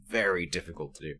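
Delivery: normal talking voice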